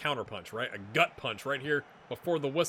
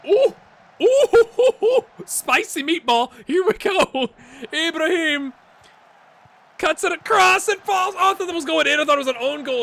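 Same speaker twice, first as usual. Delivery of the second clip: high, loud whooping play-by-play; excited attack commentary; 12 semitones higher, 9 dB louder than usual. Real, not acted. Gamer watches Ibrahim cut it across, thrilled high shouting. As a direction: shout the chance as it unfolds.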